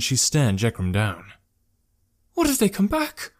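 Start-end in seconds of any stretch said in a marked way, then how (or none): 2.3-3.3 high, anxious character voice; worried. A narrator's reading. Traveller afraid the bandits will return, anxious. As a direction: plead in a high, fearful pitch. Scared traveller pleading with rescuer.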